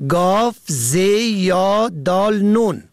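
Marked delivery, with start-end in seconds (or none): none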